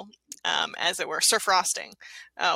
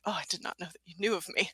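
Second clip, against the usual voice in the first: soft, quick modest mumble; taken aback; 3 semitones lower, 11 dB quieter, much faster than usual. Acted. Gingerbread general surprised Santa knows him, soft and taken aback. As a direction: hurry it out under your breath, slightly thrown. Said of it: "He's a little taken aback by that".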